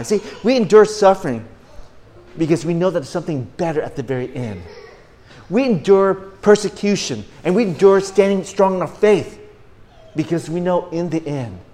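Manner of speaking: high, loud preacher's proclamation; preaching. Real, not acted. Preacher urges church to endure suffering, emphatic preaching. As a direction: drive each point home from the pulpit.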